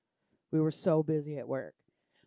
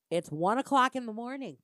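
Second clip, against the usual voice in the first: exasperated outburst; 6 semitones higher, 5 dB louder than usual. Real, not acted. Exasperated retort to friend.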